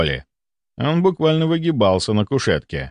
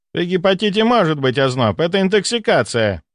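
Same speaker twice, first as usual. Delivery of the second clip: higher, less certain argument; wavering voice; 8 semitones higher than usual. A narrator's reading. Doctor defends his diagnosis, voice wavering. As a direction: argue on while losing confidence. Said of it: "но голос его дрогнул".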